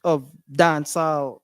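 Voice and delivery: ordinary delivery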